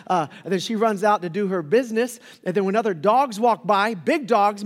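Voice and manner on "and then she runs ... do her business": usual speaking voice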